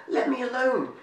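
a frightened little creature gasping to be let go; gasped in fright. A narrator's reading. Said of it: "gasped Jub".

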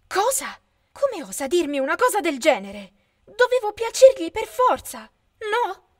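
high, loud, rising disbelief; outraged cry of hurt pride; shocked voice climbing high and loud; shocked outrage. A narrator's reading. Lara stung by rejection.

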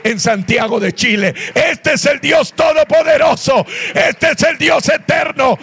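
high-pitched, shouted sermon climax; fervent preaching. Real, not acted. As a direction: proclaim it to the whole congregation at full force. Preaching climax at full force.

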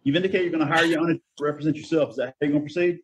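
ordinary talk

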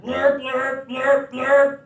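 loud blaring honks; horn honk. Pretend. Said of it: "like a horn".

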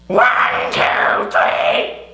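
huge, harsh Neanderthal shout from the body; full-force nasal-throated Neanderthal bellow; very loud Neanderthal. Shown as a demonstration. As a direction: drive it loud from a heavy chest and throat. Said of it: "very loud".

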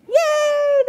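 high excited hooray; cheering crowd. Acted. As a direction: shout it like a happy crowd. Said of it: "the crowd shouted".

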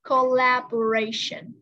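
"Collaboration" slowly, every syllable drawn out; slow word demonstration. Shown as a demonstration. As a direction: model "Collaboration" slowly for the student. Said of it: "tiếp". (đọc mẫu chậm "Collaboration")

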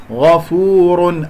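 غفور drawn out with heavy ghayn; slow tajweed. Shown as a demonstration. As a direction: recite غفور slowly. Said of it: "الغين مفخمة".

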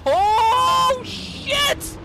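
very high, loud exclamation; high drawn-out yelp; astonished shout. Real, not acted. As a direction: cry out in amazed shock. Startled by result in game.

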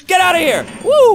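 high frantic shout; panicked yelling. Real, not acted. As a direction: yell in real fright. Fleeing monster.

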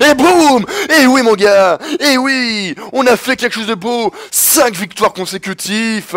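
high, loud victory cry; triumphant shouting. Real, not acted. Gamer celebrating five straight wins with the guys, triumphant shouting. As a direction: crow the win, pitched high. Celebrates winning match point.

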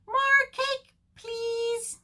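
very high fish voice; fish character. Acted. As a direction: squeak a polite request as a fish. Fish asks penguin for more.

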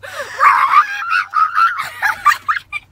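loud, very high mock shriek; exaggerated piercing imitation squeal; imitated shriek. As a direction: screech like someone reacting in outrage, hammed up. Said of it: "she'd go".

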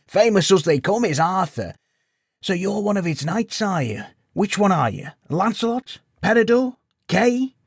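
put-on high, loud old villager; teasing old codger listing knights; old man voice. A narrator's reading. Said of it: "wizened old man speaking".

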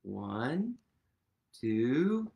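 ordinary delivery

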